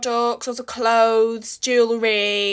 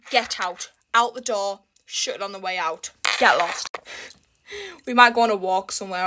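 normal talking voice

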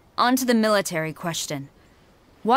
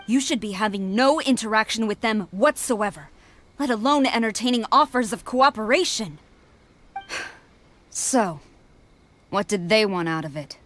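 ordinary delivery

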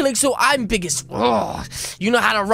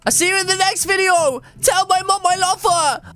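high, hammed-up parting cry; called-out farewell; 6 semitones higher than usual. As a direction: call out last words as you fall away. Calling last words while falling.

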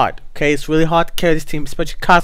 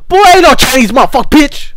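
angry retort; 6 semitones higher, 4 dB louder than usual. Real, not acted. Angry retort to a mocking kid.

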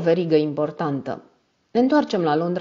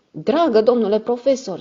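a higher, louder pleading cry; cried out; 6 semitones higher, 4 dB louder than usual. A narrator's reading. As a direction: call out to stop the old man. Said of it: "țipă el".

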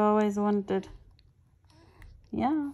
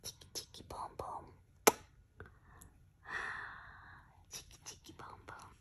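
whispered rhyme; 16 dB quieter, faster than usual. Real, not acted. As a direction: breathe the rhyme to her.

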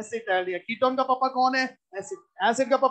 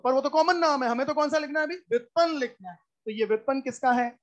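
normal talking voice

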